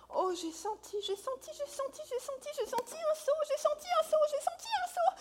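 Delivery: rising giddy repeated cry; excited squeal. Acted.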